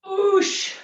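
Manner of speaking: effort grunt. Real, not acted. Strains through the last reps.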